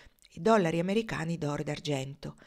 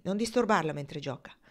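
ordinary delivery

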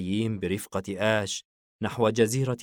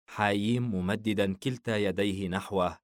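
ordinary delivery

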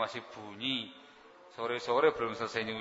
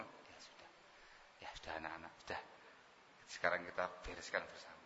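soft, hushed, reassuring; calm her down gently and quietly; soothing husband; 5 semitones lower, 15 dB quieter than usual. Pretend. Husband soothing crying wife, soft and hushed.

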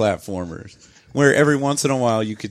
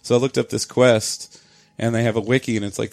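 ordinary delivery

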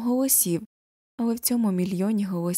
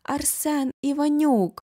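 high, slow stern call of a name; agitated teacher calling out slowly, high; 7 semitones higher, slower than usual. A narrator's reading. Math teacher calls her pupil in the arena, agitated. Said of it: "додає схвильована Ганна Павлівна".

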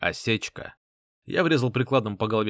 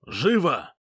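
urgent command; 4 semitones higher than usual. Acted. Orders medic urgently.

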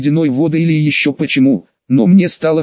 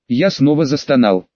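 ordinary delivery, nothing marked

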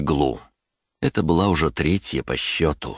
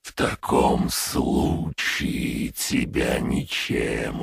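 calm command from the dark; dark lord; 2 semitones lower, much slower than usual. A narrator's reading. Lich voice held.

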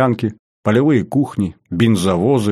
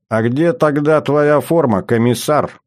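higher-pitched, needling question; snide jibe; 5 semitones higher than usual. A narrator's reading. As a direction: taunt him with mock curiosity. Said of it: "съехидничал молодой красноармеец".

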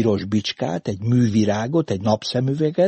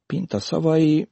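usual speaking voice

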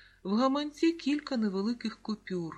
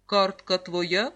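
stern; 7 dB louder, slower than usual. A narrator's reading. Policeman questions boy about the bank card, stern. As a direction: question him severely, spacing the words. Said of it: "суворо запитав охоронець порядку".

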